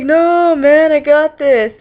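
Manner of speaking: high, loud, drawn-out stoner voice; hammed-up spaced-out confidence; stoned alien. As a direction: stretch it out like an overconfident stoner. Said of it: "they were, like, stoned".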